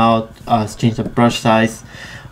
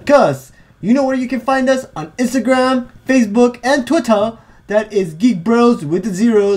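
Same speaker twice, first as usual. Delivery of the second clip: announcer voice; 9 semitones higher than usual. Acted. Reads out social media handles.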